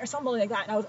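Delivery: ordinary talk, nothing marked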